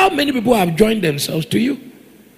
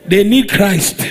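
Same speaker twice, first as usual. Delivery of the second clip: ordinary delivery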